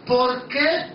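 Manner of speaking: ordinary talk, nothing marked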